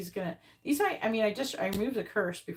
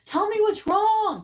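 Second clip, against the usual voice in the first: high, loud and slow, whiny pleading; overdone mock plea; mock needy texter; 13 semitones higher, 11 dB louder, slower than usual. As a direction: mimic a clingy, needy message. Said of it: "Don't be like".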